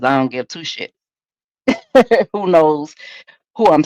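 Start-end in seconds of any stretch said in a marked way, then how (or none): 1.7-2.3 short laugh. Real, not acted. Laughing at own remark.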